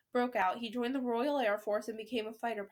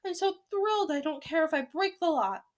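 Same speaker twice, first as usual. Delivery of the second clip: high, loud, carefree outburst; raised storybook grandmother exclamation; thrilled shout; 5 semitones higher, 6 dB louder than usual. A narrator's reading. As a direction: shout it, giddy with excitement. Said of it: "she shouted".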